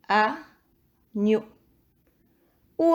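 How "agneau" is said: Agneau. cut into two syllables; measured Agneau. with a gap mid-word. Shown as a demonstration. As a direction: repeat "Agneau." as a, pause, gneau. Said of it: "Première ou deuxième syllabe".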